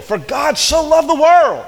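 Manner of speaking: high, loud, slow cry of the verse; shouted climax. Acted. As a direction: cry it out to the back row.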